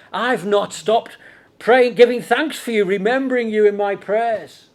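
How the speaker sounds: high, emphatic preacher's quotation; ringing pulpit delivery of a letter; scripture quotation. Acted. Quotes Paul's letter aloud.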